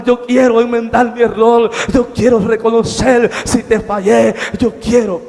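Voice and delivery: loud, high pleading to God; retold pleading prayer. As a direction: beg God for mercy from hell. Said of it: "Y yo decía".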